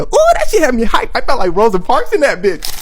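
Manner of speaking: the comic relives his triumph with loud excitement; hyped. Real, not acted. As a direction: shout it like you just won. Triumphant payoff of the story.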